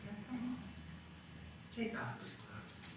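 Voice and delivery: very quiet, half-whispered seduction; soft coaxing.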